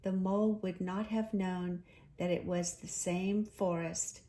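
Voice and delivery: ordinary delivery, nothing marked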